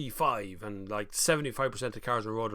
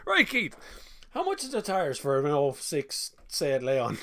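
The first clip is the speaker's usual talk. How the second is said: playful game-show host voice; mock quizmaster; 3 semitones higher, 4 dB louder, slower than usual. Host quizzing [name], playful game-show voice.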